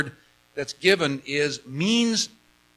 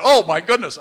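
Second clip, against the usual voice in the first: loud, higher burst of joyful amazement; excited exclamation; 4 semitones higher, 8 dB louder than usual. Retold or imitated. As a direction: relive being thrilled by a discovery, louder. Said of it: "I get excited about that".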